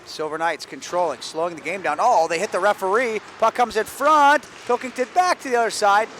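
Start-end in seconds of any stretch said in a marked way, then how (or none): 2.0-6.1 excited play-by-play. Real, not acted. Sudden scramble in front.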